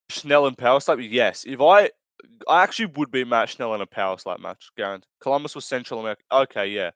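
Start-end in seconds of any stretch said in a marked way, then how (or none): none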